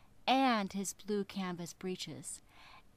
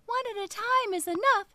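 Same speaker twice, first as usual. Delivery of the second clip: high, louder squeaky fish voice; little fish voice; 11 semitones higher, 6 dB louder, faster than usual. Acted. Little fish flatters the whale, high and piping. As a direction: pipe up like a tiny clever fish.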